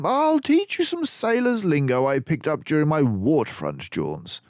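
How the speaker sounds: high, loud brisk detective voice; Basil's voice. A narrator's reading. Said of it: "for he said".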